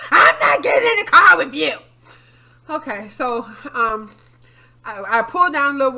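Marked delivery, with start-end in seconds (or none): 0.1-1.7 mimicking mom. Mother refusing, voiced.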